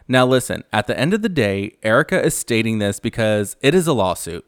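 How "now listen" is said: ordinary talk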